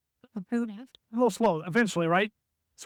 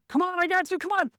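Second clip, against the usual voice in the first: higher call, come on; eager screen call, pitched up; calling to a teammate; 10 semitones higher than usual. Shown as a demonstration. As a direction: urge your teammate to the screen. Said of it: "If you're gonna go screen".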